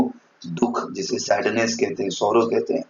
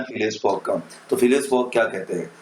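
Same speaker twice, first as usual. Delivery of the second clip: ordinary talk, nothing marked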